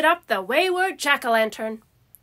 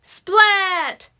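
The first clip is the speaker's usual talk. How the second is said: cheering; 4 semitones higher than usual. A narrator's reading. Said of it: "they all cheered".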